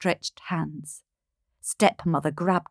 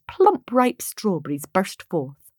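ordinary delivery, nothing marked

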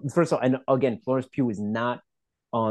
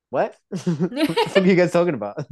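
higher-pitched baffled quote broken by laughter; amused bewilderment; 5 semitones higher than usual. Retold or imitated. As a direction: act bewildered while cracking up. Voicing bewildered Peterson, laughing.

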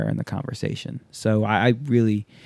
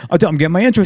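higher, louder mock friend; mock Waz; 7 semitones higher, 7 dB louder, much faster than usual. Imitating Waz explaining his intro, higher and louder. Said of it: "Waz's reply voiced".

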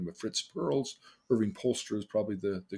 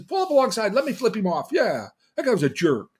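higher, louder, egging him on; eager girlfriend; 9 semitones higher, 7 dB louder than usual. Retold or imitated. Girlfriend urging him to chase the driver, eager and louder. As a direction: cheer him on from the passenger seat.

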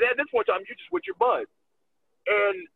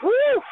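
loud whew; 6 dB louder than usual. Real, not acted. Exhale before answering.

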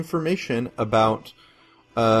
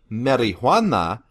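"marijuana" with Spanish vowels and breathy h; Spanish accent; 4 dB louder, slower than usual. Shown as a demonstration. Host insists on saying the drug's name, Spanish accent. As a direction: say "marijuana" the Spanish way, mari-HWA-na. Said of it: "insistence on saying it in the Spanish accent".

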